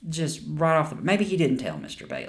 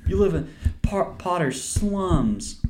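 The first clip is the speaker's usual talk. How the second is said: much higher, louder, very slow sneer; mock villain line; 9 semitones higher, 5 dB louder, slower than usual. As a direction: stretch it out like a gloating villain. Mocking a movie line.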